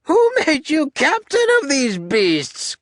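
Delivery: high, slow, ranting tyrant; Alexander. Acted. General berates the captain between kicks, high-pitched. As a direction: demand it in a piercing high pitch. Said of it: "He has a high-pitched voice".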